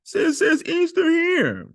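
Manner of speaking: mock the naysayers in a squeaky high voice; high, loud parody of a doubter; mocking objector. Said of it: "some people would say".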